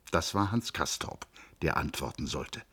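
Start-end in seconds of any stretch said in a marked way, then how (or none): none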